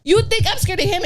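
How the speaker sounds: high, loud, outraged yell at him; retold furious shout. Retelling her reply to the ex's message, outraged shout.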